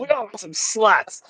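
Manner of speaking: dopey cartoon teen voice; Beavis and Butthead. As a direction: imitate a dim cartoon slacker. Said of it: "on the Beavis and Butthead Do".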